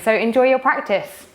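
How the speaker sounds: ordinary talk, nothing marked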